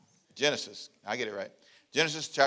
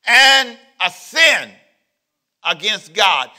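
loud, much higher, very slow; thundering preaching; 3 semitones higher, 13 dB louder, much slower than usual. Shown as a demonstration. Preacher declaring sin against God, loud and slow. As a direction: hammer each word home. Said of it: "Strong word".

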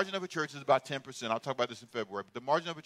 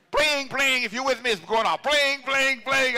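high, loud mock alarm ring; 6 semitones higher, 3 dB louder than usual. Preacher imitating his crazy alarm, high mock ring.

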